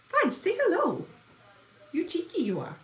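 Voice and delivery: high sing-song voice for the dog; pet talk. Real, not acted. Owner coaxing her dog Fudge to greet viewers, high cooing pet talk. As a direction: coo at the puppy to say hello.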